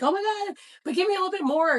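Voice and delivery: high, loud thrilled outburst; excited freak-out. Retold or imitated. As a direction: squeal it like you can't wait. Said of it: "freak out about and be like".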